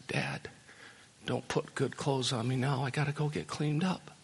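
hushed, ending in a whisper; sheepish son pleading quietly; soft ashamed plea. Retold or imitated. Prodigal son to his father, hushed and ashamed. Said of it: "If I were the prodigal son, I'd say".

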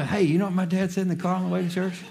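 put-on fast, high, laughing kid voice; tattling kid. Son running to tell the fella what dad said, eager and laughing.

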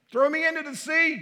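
high, loud urgent cry; desperate plea. Acted. Jonah crying out to the sailors, high and loud. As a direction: throw yourself on the sailors' mercy.

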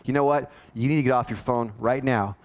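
low, controlled parental firmness; firm tone. Shown as a demonstration. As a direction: lay down the rule firmly without raising your voice. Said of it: "speak in a firm tone".

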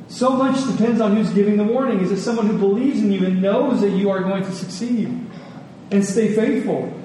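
ordinary talk, nothing marked

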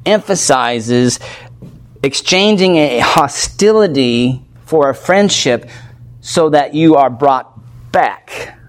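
ordinary talk, nothing marked

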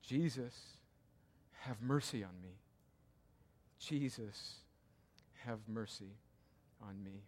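soft, low, repeated plea; whisper-like pleading for mercy; preacher praying the beggar's words; humble prayer. Acted.

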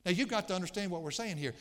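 ordinary talk

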